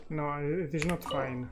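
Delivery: ordinary delivery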